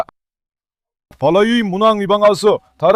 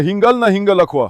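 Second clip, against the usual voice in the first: ordinary talk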